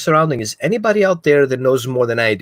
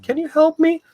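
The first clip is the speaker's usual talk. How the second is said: much higher, small pleading dog voice. Retold or imitated. Dog asking its owner for help, much higher pleading voice. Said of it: "And your dog is like".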